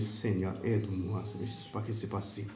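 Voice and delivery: a frightened whisper to God; soft desperate prayer under the breath. Retold or imitated. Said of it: "Il prie".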